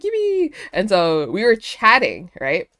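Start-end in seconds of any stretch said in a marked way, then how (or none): 0.0-0.5 high-pitched little cheer; gleeful cheer. Retold or imitated. Teller cheering with the excited girls, gleeful. Retold delight at giving stickers.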